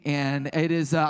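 ordinary talk

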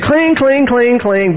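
high, clanging piano noises; imitated piano clanging. Preacher mimicking the lady at the piano, comic clanging. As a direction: mimic someone banging piano keys. Pianist clangs over the hymn.

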